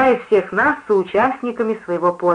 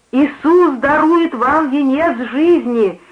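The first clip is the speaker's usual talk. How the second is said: high, slow, exultant preaching; jubilant proclamation; 6 semitones higher, 3 dB louder, slower than usual. A narrator's reading. Preacher proclaiming to the drunkard, jubilant. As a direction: proclaim it slowly, high and triumphant. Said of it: "ликующе возгласила проповедница".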